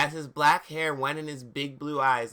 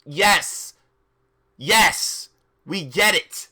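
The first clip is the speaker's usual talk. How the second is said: louder, exasperated yelling at the story; fed up, shout it back at the text; loud fed-up retort; 3 semitones higher, 6 dB louder, slower than usual. Real, not acted. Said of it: "'cause I'm gothic?".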